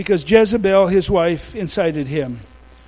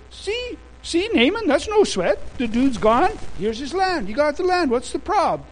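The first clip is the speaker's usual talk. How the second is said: high, smug wife voice; gloating Jezebel; 6 semitones higher than usual. Retold or imitated.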